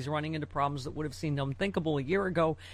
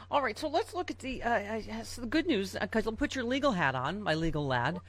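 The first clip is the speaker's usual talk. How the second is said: usual speaking voice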